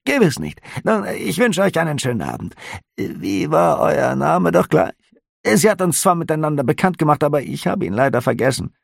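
high, curious innkeeper; a nosy innkeeper asking lightly; innkeeper's voice. A narrator's reading. Innkeeper questioning the officer, high and curious.

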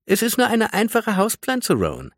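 very high singsong taunt, dropping on the name; mocking trill. A narrator's reading. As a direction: tease in a high, sweet lilt. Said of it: "trällerte sie spöttisch". (spöttisch geträllert)

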